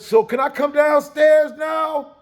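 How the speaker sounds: 階下へ大声で呼びかける; 二階から下に向かって高く叫ぶ; calling downstairs. Retold or imitated. Boy calls down the stairs.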